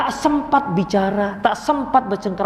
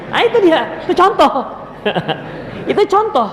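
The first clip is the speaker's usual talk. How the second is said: caught you; 10 semitones higher than usual. Real, not acted. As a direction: point out the distracted listener with a laugh. Pointing at distracted listener.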